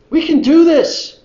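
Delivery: high, slow, loud cheer of confidence; Caleb rallying. Acted. Caleb urging Israel they can take the land, high and loud. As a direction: pump up the crowd. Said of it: "And so Caleb stands up".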